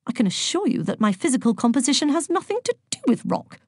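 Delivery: offended know-it-all pupil; disdainful. A narrator's reading. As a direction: correct them haughtily. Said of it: "said Virgo disdainfully".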